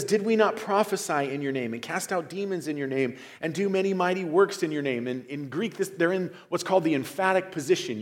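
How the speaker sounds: preaching at full force. Acted. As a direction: drive home the emphasis.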